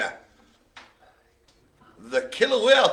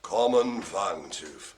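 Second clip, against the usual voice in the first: slow announcement; 9 semitones lower, 7 dB quieter, much slower than usual. Shown as a demonstration. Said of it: "slow dramatic repeat".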